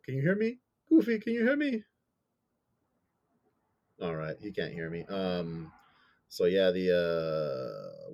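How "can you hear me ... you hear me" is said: calling out. Real, not acted. Calling out to lost cohost.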